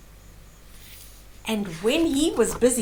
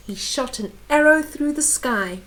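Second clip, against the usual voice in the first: plain reading voice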